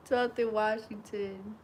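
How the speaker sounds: ordinary delivery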